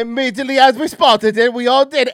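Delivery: loud, high put-on Jamaican accent; mock Jamaican accent. Mimicking the snake hunter, mock Jamaican accent. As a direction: exaggerate a Jamaican lilt. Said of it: "doing a Jamaican accent".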